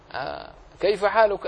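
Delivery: usual speaking voice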